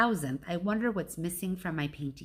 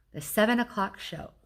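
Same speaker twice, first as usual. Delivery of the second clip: ordinary delivery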